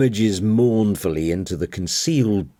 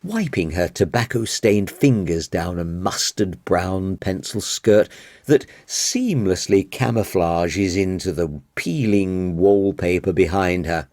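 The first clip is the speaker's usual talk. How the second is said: ordinary delivery, nothing marked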